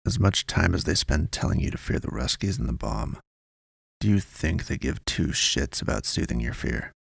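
lightly voiced, low and hushed informant; cagey informant. A narrator's reading. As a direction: pass on the tip discreetly, in fragments.

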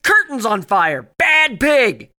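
scolding shout. Acted. Pig sets curtains on fire.

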